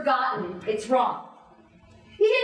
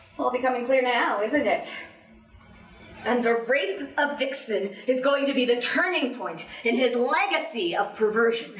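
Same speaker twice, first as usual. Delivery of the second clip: normal talking voice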